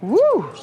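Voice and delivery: high loud whoop; excited whoop. Real, not acted. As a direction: whoop at the monster fish. Big fish surfacing.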